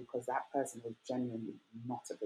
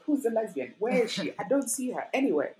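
higher, playful mock confusion; mock searching; 7 semitones higher than usual. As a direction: pretend to look around for her, pitched up. Mock looking around.

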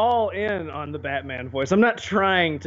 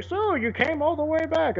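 much higher, strange Bane voice; Bane impression; 10 semitones higher than usual. Quoting the movie villain in a much higher, altered voice. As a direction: do your best Bane. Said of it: "That's close to my Bane I can do".

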